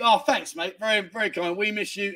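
normal talking voice

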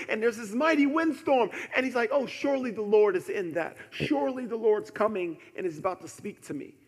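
high, loud, impassioned storytelling sermon; full-force preaching. Acted. Preacher telling of Elijah's mighty windstorm, full-force preaching. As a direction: belt out the story to the room.

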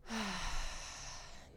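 long, soft breath out; contented sigh. Pretend. Leader enjoying the warming earth energy, long soft sigh. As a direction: exhale as if soaking it in. Breath before nice and warm.

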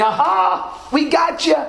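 high-pitched gloating catch; mock gloating. Preacher quoting enemies who delight in downfall, mock gloating. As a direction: crow it as a gleeful accuser.

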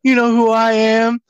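high, drawn-out smooth come-on; suave pickup voice. Retold or imitated. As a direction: croon the name, very slow and high.

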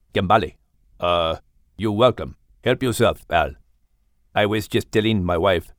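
low, mysterious big cat voice; deep panther with Malay accent. Acted. Java the panther.

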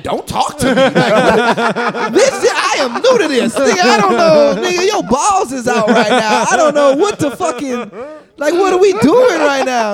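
high, loud, laughing panic; panicking as the naked man talks to him; panicked outburst. Retold or imitated. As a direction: blurt it out, overwhelmed.

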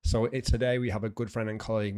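ordinary talk, nothing marked

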